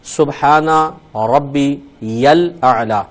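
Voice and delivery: slow drawn-out recitation; recited tasbih. Shown as a demonstration. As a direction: chant it slowly and devoutly. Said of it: "تین بار پھر آپ تسبیح پڑھیں".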